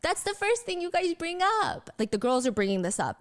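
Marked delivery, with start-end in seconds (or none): 0.0-1.9 high-pitched playful protest; mock-indignant, kept light; lighthearted complaint. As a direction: imitate girls teasingly scolding the hosts. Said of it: "very lighthearted".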